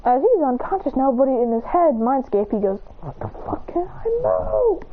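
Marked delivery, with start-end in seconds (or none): 3.0-4.8 slow, high acted wail; strained distress. Unconscious in his mindscape and unable to move, strained distress. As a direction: stretch it into a rising, strained cry.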